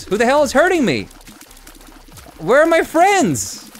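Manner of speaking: high, loud, whiny outburst; exasperated whine. Real, not acted. Frustrated gamer complaining at screen.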